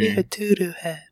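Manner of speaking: put-on high childish taunt; squeaky kid calling names; high, bratty little-kid voice. Kid heckling the butler.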